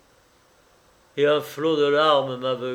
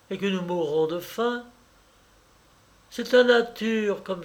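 put-on high, light young woman's voice; dreamy coquette playing house; girlish Juliette; 6 semitones higher than usual. A narrator's reading. Young mistress daydreaming to her lover, high and light.

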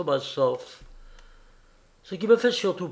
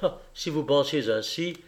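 usual speaking voice

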